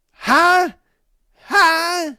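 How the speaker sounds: high, loud baffled huh; incredulous. Real, not acted. Host reacting to the snobbish passenger, loudly incredulous. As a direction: bark a high huh in disbelief.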